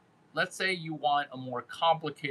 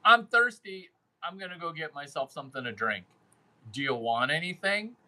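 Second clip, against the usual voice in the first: fast casual offer; 2 semitones higher than usual. Shown as a demonstration.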